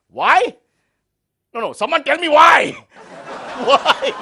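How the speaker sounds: loud exasperated why; shouting. Acted. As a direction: demand an answer, yelling. Host demands why, shouting.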